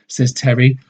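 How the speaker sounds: plain reading voice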